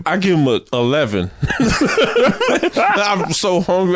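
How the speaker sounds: laughing while talking; breaking into laughter mid-line. Real, not acted. Laughing through his rating.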